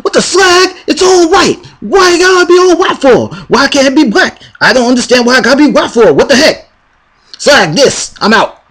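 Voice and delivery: squeaky indignant complaining; high-pitched rant. Acted. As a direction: rant high and fast like you're outraged.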